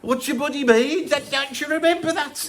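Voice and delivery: high, loud Brummie put-on voice of an aggrieved musician. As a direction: play the miffed pop star demanding he's paid up. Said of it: "I'm doing the [name] part there".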